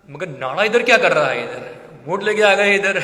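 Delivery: loud mock astonishment; acted bewilderment. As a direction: question it loudly, imitating someone taken aback. Acting Mahaprabhu's puzzlement on seeing the boat. (हैरानी की नकल में ज़ोर से सवाल)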